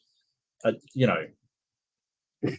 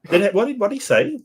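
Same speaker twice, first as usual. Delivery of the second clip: high, loud disbelief; stunned, what did he just say; shocked; 11 semitones higher, 5 dB louder, faster than usual. Real, not acted. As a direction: pitch jumps up in disbelief. Shocked at claim.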